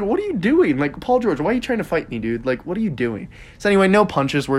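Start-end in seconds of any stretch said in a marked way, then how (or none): 0.0-3.3 incredulous. Retold or imitated. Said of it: "he's like".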